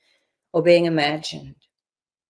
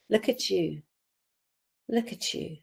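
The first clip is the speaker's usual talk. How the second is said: much softer, hushed and tender; 8 dB quieter than usual. Shown as a demonstration. Coach addressing the imagined child, hushed and tender. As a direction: murmur as if to a small child.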